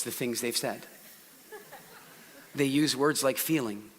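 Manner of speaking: soft and low, with long slow pauses; quiet, long pauses. Shown as a demonstration. Showing the audience a kinesthetic speaker, soft slow pauses. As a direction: settle them down gently. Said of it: "talk really quietly ... long pauses".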